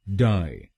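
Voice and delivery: डाई said as die, cleanly on its own; syllable demo. Shown as a demonstration. Teacher models the first syllable, die. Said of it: "हम बोलेंगे डाई".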